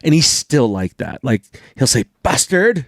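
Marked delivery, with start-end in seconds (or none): 2.2-2.8 higher, indignant "Bastard"; a hint of a mad dad spitting out "Bastard"; dad's mild curse. Dad who never swears, mildly mad, higher "Bastard". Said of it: "if he's really mad".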